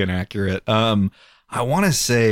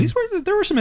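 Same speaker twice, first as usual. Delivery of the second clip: playful sing-song. Real, not acted. Echoing host playfully.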